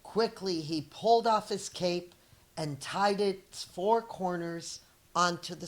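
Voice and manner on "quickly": ordinary delivery, nothing marked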